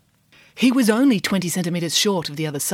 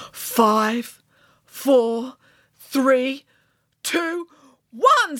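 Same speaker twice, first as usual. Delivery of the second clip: rising countdown; 5 semitones higher, much slower than usual. Acted. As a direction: pitch climbing each number.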